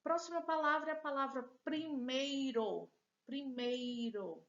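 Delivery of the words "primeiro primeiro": primeiro stretched as pri-mei-ro; syllable by syllable. Shown as a demonstration. Teacher presenting a word to students, primeiro in stretched syllables. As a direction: sound out each syllable of primeiro. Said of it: "Temos três sílabas".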